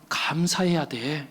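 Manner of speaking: slow, low, hushed reassurance; soothing mother. Retold or imitated. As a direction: imitate a mother tenderly consoling, sinking to a near whisper. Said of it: "하면서 다독여 주었다는".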